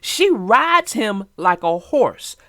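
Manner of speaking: plain reading voice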